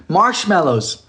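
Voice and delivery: ordinary talk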